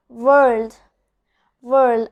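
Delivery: world with a full rolled er before the L; strong R in world. Shown as a demonstration. Teacher demonstrating the R sound. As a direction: lean on the R in world. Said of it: "a very strong R sound".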